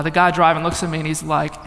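ordinary delivery

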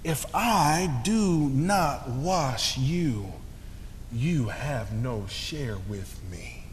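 slow, soft and tender; Jesus tenderly answering Peter, soft and slow; sweetly. Retold or imitated. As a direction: say it gently, very slowly. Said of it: "Jesus says so sweetly".

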